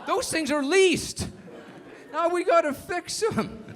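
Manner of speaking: a boss furious about leased porta potties; screaming CEO. Retold or imitated. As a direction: Chevron CEO yelling at the HR head, hammed-up anger. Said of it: "he's screaming at the guy".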